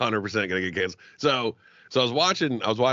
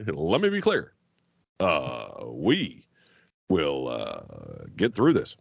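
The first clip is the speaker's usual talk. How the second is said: a light, halting presidential cadence with long pauses; a hint of a measured statesman addressing the nation; Barack Obama voice; 8 semitones lower, much slower than usual. Mocking TV interviewees, a lightly voiced measured president. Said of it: "they all sounded like Barack Obama".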